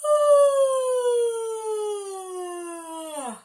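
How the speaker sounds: loud high stretching yawn; big yawn. Shown as a demonstration. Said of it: "And a big yawn".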